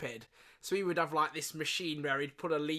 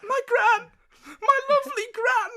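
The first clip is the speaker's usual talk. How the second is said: much higher, louder hammed-up wail; mock crying; 8 dB louder than usual. Grandson mock crying over his dead nan, hammed-up wail. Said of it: "He's like ... crying".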